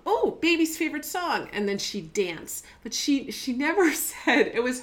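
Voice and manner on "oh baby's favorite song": imitating happy daughter. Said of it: "Or songs".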